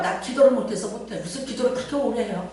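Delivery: ordinary delivery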